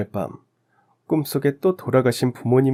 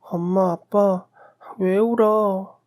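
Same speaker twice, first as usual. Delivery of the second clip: put-on high, slow little-girl voice, pleading; a small child crying out to her parents; child's voice; 10 semitones higher, much slower than usual. Dream, narrator became a little child. (높고 느린 어린아이 목소리)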